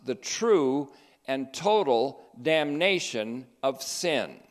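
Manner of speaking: solemn quotation reading. Acted. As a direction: read the theologian's words with gravity, slowly. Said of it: "He said this".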